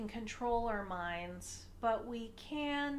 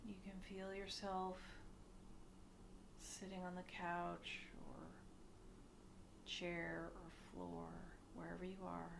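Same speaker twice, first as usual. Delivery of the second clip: soft meditation voice with long pauses, growing quieter; hushed, unhurried guiding, sinking softer as it goes; guided meditation; 3 semitones lower, 10 dB quieter than usual. Acted. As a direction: ease them gently into stillness.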